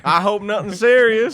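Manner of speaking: mock accent as Lloyd; playful put-on accent; hammed-up accent. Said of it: "that was our best accents".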